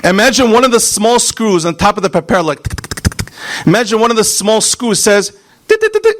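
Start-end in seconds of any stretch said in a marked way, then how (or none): 2.4-3.4 soft breathy tick tick of a loose screw; hushed clock-like ticking; whispered ticking. Retold or imitated. 5.7-6.1 much higher squeaky tick tick; high mechanical ticking sound. Retold or imitated. Screw ticking loose.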